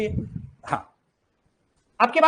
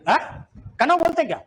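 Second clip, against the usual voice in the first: ordinary delivery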